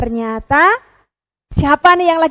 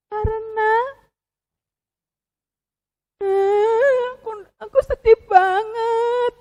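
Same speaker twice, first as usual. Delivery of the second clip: mock crying penguin; 3 semitones higher than usual. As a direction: falter and stammer through fake tears. Penguin puppet breaks down crying.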